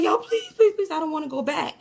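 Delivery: very high, desperate "please"; imitated screaming plea. Boy in a tug-of-war begging not to go back, screaming plea. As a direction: act out a panicked cry, pitch leaping up. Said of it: "the way he was screaming along".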